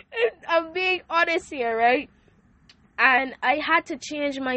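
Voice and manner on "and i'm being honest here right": tearful. Real, not acted. Tearful after reliving prayer.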